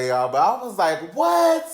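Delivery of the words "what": very high, loud What; a very high, loud shocked yell. Retold or imitated. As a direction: shriek it in disbelief. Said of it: "I'm sorry to yell".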